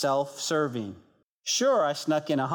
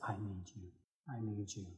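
much softer, near silent plea; soft prayer; 4 semitones lower, 16 dB quieter than usual. Acted. A believer pleading to the Lord, very soft. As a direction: breathe it out to God.

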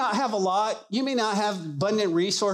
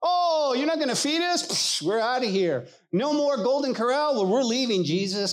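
mocking huff; 7 semitones higher than usual. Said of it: "many of them walked with God no more".

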